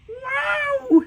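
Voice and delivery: very high, loud cartoon yelp; sudden high-pitched wail of a falling character; tripping yelp. Acts out gator suddenly tripping.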